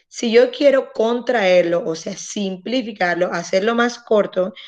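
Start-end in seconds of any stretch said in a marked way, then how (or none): none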